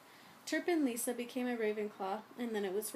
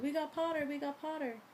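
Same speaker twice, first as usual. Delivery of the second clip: gleeful, raised cheering; twins yelling; 5 semitones higher than usual. A narrator's reading. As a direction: crow it out over the noise. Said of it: "the Weasley twins yelled".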